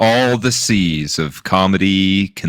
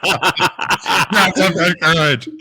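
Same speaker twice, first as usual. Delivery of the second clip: laughing; 6 semitones higher, much slower than usual. Real, not acted. Laughing slowly through words.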